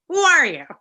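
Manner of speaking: high, loud mock disbelief; incredulous. Musician answering a stranger's band offer, incredulous.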